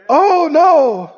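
sudden loud high "Oh no!"; panicked pilot's cry; alarmed cry. Retold or imitated. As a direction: yelp it in shock as the gear scrapes. Pilot realizing gear is up.

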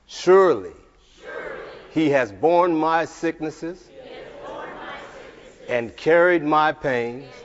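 forceful, drawn-out faith declaration; preach. Real, not acted. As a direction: proclaim each phrase loud and slow, with long pauses.

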